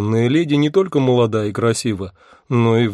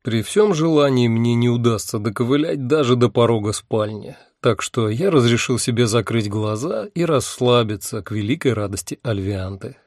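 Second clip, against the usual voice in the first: ordinary delivery, nothing marked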